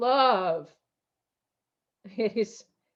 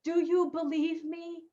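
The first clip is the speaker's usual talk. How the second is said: a softer, higher inner voice asking gently; a hint of an inner voice, softer and higher; voice of knowing; 6 semitones higher, 6 dB quieter than usual. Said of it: "If your knowing said to you and asked you".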